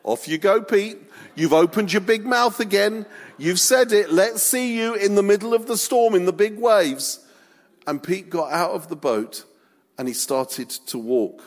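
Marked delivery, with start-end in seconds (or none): 0.0-7.2 high, goading taunt; jeering disciples. Acted. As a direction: egg a mate on mockingly. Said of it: "other disciples were like".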